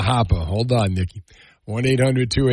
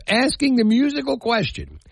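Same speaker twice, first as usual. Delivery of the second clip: mock announcer voice; 10 semitones higher than usual. Shown as a demonstration. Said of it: "announcer flourish".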